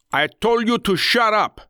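high-pitched, furious order; angry shout. A narrator's reading. Ringleader silencing his screaming captive, shouting angrily. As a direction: shout it as a gang boss out of patience. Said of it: "the ringleader of the gang shouted".

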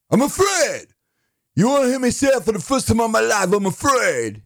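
high-pitched, strained cry of fear; overacted fearful catchphrase, pitched very high; hammed-up movie cliche. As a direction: wail the famous line way up high. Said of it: "let's do a couple cliches".

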